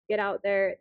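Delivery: normal talking voice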